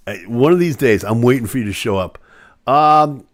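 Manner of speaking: normal talking voice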